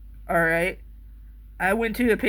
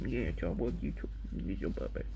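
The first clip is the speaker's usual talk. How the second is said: much lower, softer cartoon voice; deep goofy dog voice; 14 semitones lower, 11 dB quieter than usual. Owner voicing the dog in a deep goofy voice. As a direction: rumble it low like a silly monster.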